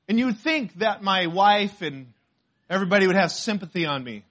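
ordinary delivery

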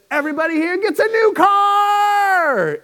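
very high, loud announcing shout; high, loud game-show announcement; Oprah shouting. Retold or imitated. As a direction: bellow it like a talk-show host giving cars away. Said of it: "and she said".

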